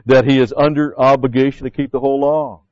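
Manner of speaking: loud, high, stressed statement; emphatic doctrine. Real, not acted. Pastor stressing doctrine to the church, loud. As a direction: hammer the point home. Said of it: "a very important point of doctrine".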